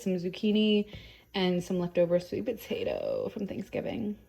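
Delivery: normal talking voice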